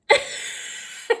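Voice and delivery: breathy burst of real laughter; helpless laugh. Real, not acted. Vlogger cracking up at her own funny story, helpless laugh.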